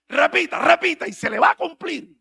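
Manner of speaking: high, loud exhortation; mocking preacher. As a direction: hype up the crowd like a showman. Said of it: "Pero ellos dicen así". (predicador exaltado en burla)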